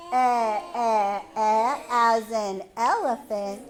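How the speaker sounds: "Eh" said as a loud short open e; phonics sound. Shown as a demonstration. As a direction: punch out "Eh" as the short e vowel. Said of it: "What does E say again?".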